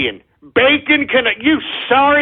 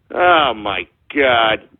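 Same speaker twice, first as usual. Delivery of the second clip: groaned disbelief; 12 semitones lower, much slower than usual. Acted. Exasperated host groaning at troll.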